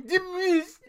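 high, loud, garbled war cry; comic high-pitched yell, sounds squashed together; mangled battle shout. Acted. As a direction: shout it like a brain-addled warrior charging in.

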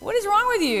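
high, loud mock disbelief; imitating an annoyed, just-woken teacher; hand-on-hip exasperation. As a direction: scold them in acted exasperation. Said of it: "Jesus with his hand on his hip".

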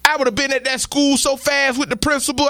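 normal talking voice

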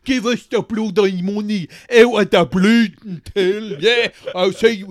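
loud Dublin hardman, barking at the till; thug robber. Acted. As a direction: play a menacing stick-up man. Acted armed robber threatening shop.

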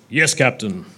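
a deep-set Klingon reply breaking into a laugh; bad Klingon accent. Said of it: "Sorry, very bad Klingon accent".

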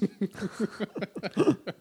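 a high burst of laughter; real amused laugh. Real, not acted. Laughs at own joke.

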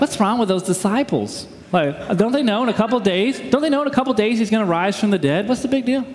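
exasperated armchair critic; judgmental jerk. Acted. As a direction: scoff like a smug know-it-all reader. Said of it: "we read the New Testament like jerks".

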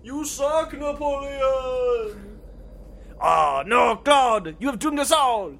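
high, loud desperate yelling; panicked shouting. Acted. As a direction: scream for help as doom comes. Ghosts attack Napoleon.

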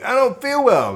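high-pitched mock complaint; mock sick child. Kid angling to stay home with Diet Coke, mock complaining. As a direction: play a kid putting on an illness.

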